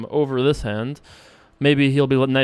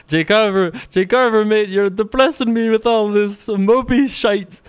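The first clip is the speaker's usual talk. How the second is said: higher, louder mock-British moaning; improvised UK accent; 7 semitones higher, 4 dB louder than usual. Streamer imitating upset UK fans, improvised British accent. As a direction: put on a hammed-up UK accent, laughing. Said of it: "that was not so bad of an accent".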